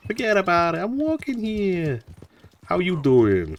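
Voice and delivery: high, drawn-out mock New York accent. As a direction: imitate New Yorkers with a hammed-up accent. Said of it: "it's just that noise again and again".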